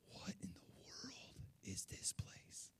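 slow, breathy whisper of disbelief; awed whisper. Retold or imitated. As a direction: whisper it slowly, as if stunned. Retold stunned reaction to the scene.

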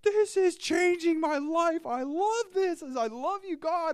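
very high, drawn-out gushing squeal; hammed-up, over-the-moon falsetto cry; acted ecstatic excitement. Preacher acting out an awesome Bible reading, ecstatic. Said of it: "it's off the charts awesome".

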